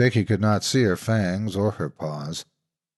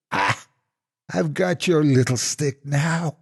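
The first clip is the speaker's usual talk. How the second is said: higher, slow triumphant sneer; gloating monster; 6 semitones higher than usual. Acted. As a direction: gloat over the captured stick. Monster catches the pole.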